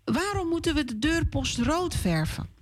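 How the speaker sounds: put-on high child voice with moeten stressed; child's question again. Hanna repeats her question to papa, high. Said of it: "vraagt Hanna aan haar papa".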